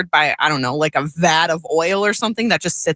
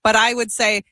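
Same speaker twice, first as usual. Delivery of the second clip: ordinary talk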